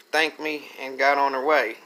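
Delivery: ordinary delivery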